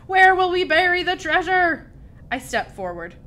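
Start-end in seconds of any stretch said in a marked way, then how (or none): 0.1-1.9 high, loud desperate question; alarmed captain's holler. A narrator's reading. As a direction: wail it out over the storm. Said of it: "hollered Braidbeard".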